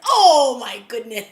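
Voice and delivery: loud, drawn-out astonished exclamation; stunned outcry. Retold or imitated. Reviewer replaying her shock at the spy reveal, loud stunned outcry. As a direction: belt out your amazement, stretching the first word.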